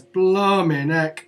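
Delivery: drawn out slowly, amazed; blimey. Acted. Guard stunned by the Bloody Sands name, slow amazed. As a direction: let it out slow in awe.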